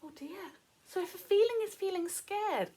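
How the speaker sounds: usual speaking voice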